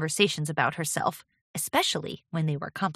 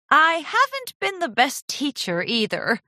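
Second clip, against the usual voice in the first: higher-pitched, mildly contrite admission; admitting fault; 8 semitones higher, 3 dB louder than usual. A narrator's reading. Mentor admitting fault to apprentice, contrite. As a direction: own up in a raised voice. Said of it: "Rarity admitted".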